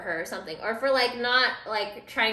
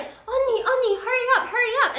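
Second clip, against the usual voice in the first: high, urgent call to hurry her along; 11 semitones higher, 5 dB louder than usual. Retold or imitated. Girl urging her friend to beat curfew, high urgent call. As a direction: wave her in, pitched way up and loud-ish.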